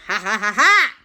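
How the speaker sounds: loud staged ha-ha, rising; triumphant laugh. Acted. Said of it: "And they went".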